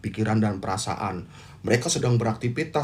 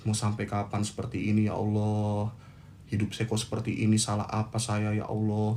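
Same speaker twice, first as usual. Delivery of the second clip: mild retold lament; 2 semitones lower, 6 dB quieter than usual. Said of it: "meratapi nasib".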